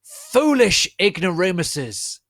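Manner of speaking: slow, loud, high sneering yell; villain yell. Acted. Voldemort yelling from his broomstick, slow sneering yell. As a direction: sneer down at them from above. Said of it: "yielded Voldemort from his broomstick".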